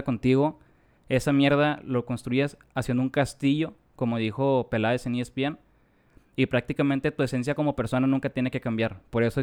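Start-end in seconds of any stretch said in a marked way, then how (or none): none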